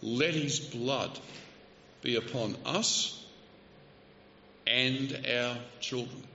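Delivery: slowed right down, every word weighed; slow solemn cry. Retold or imitated. Preacher slowly quoting the crowd's cry, grave and drawn out. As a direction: let each word fall heavy and slow.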